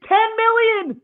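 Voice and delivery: screamed high and loud; shouted outrage. Real, not acted. Podcaster raging at a cheap contract offer, outraged shout. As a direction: scream the number in disbelief. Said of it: "That's the end of my crash out".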